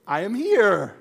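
very high, loud sing-song hello; voicing the incisions. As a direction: make the wound announce itself cheerfully. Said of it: "incisions goes".

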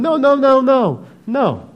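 high, loud frantic noes; defensive panic; panicked denial. Acted. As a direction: back away in panic. Preacher voices Peter's panicked denial.

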